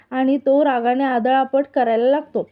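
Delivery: ordinary delivery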